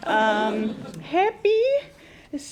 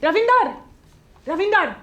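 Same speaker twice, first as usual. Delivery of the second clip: raised shout for someone off the phone; calling out; 5 semitones higher, 4 dB louder than usual. Acted. Phone operator calling a colleague about a threat, calling out. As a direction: call out for help, high and loud. Calls out to someone else nearby.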